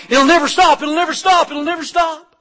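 high, loud panicked yell; yelling crowd. Retold or imitated. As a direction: shout it like the crowd as the boat runs off. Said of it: "they started yelling".

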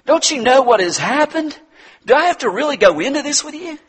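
high, loud exasperated snapping back; indignant retort. Retold or imitated. Woman at the tomb snapping back at the stranger, loud and exasperated. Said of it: "she could have shot back and said".

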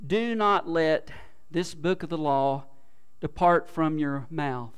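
each phrase slowly and deliberately, "mouth" landing heavy; slow scripture reading. Shown as a demonstration. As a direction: read it out word by word. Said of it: "slow emphatic scripture reading".

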